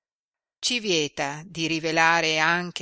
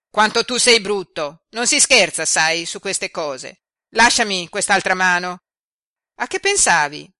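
put-on louder, playfully reproachful girl; scolding young girl; 2 semitones higher, 9 dB louder than usual. A narrator's reading. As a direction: scold him lightly like a lively girl. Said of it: "riprendendo la vivacità".

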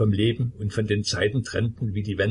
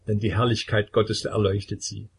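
ordinary delivery

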